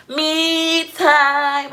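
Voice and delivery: playful. Real, not acted. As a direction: savor the words, teasing. Teasing kids over mommy time.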